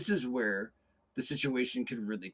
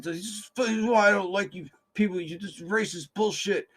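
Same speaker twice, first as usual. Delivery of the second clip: higher, louder mock outburst of a cornered man; a retold angry yell, higher and louder; imitating angry man; 7 semitones higher, 5 dB louder than usual. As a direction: throw the accusation back, hammed-up anger. Angry customer voiced in retelling.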